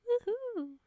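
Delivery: high, loud woohoo; excited cheer. Real, not acted. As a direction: cheer with delight. Cheering a shout out.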